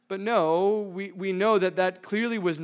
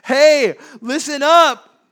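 high, loud, slow "listen up"; a mother demanding attention, loud; mock stern call; 8 semitones higher, 14 dB louder, much slower than usual. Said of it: "She starts with".

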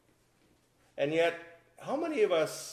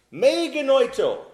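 Me genoito thundered high, loud and slow; emphatic Greek exclamation; 11 semitones higher, 10 dB louder, slower than usual. Shown as a demonstration. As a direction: hurl Me genoito like a verdict. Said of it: "Paul uses me genoito, a little Greek phrase".

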